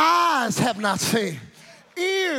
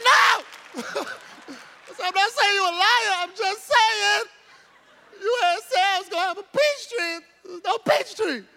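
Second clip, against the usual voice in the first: put-on high-pitched pleading kid voice; high, mock-whiny backpedalling; whining child; 8 semitones higher, slower than usual. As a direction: protest like a tearful little kid, hammed up. Frightened child protesting back to father.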